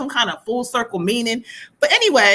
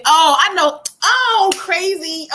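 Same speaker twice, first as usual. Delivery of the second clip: surprised delight; 7 semitones higher, much slower than usual. Real, not acted. Reacting to the spirit's joke.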